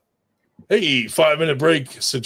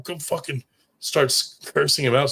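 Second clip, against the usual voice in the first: ordinary delivery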